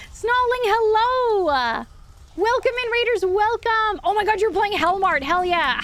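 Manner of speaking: high, bright excited greeting; cute anime streamer. Real, not acted. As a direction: squeal a happy welcome.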